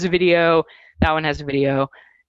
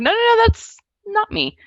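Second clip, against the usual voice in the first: high, hurried denial; mock protest. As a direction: wave off the mix-up in a raised voice. Correcting people who mistake her.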